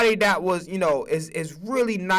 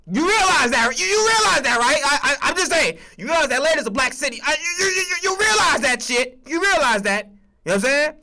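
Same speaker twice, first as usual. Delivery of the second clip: loud, high, incredulous ranting; exasperated yelling; 7 semitones higher, 4 dB louder than usual. Real, not acted. Fan yelling at the team about their hire, loud and incredulous. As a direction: yell it at the front office in disbelief.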